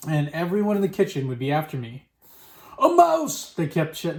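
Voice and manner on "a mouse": very high cry of alarm; shouting. A narrator's reading. Kitchen staff spot a falling mouse, shouting. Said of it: "they were shouting".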